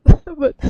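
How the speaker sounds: a bursting high laugh at her own accent; embarrassed laugh. Real, not acted. Streamer laughing at her own accent, high laugh. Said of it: "I'm trying to do my best".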